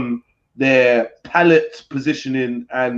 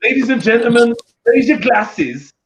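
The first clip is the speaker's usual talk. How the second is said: mock toast; 7 semitones higher, 4 dB louder than usual. Raising a mock toast to show the posh glasses, grand. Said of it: "Like what?".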